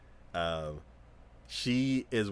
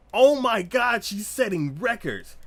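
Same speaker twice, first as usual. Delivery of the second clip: loud, high excited exclamation; amazed crowd; 11 semitones higher, 8 dB louder than usual. Retold or imitated. Crowd watching records being broken, amazed. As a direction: cry out like a stunned fan.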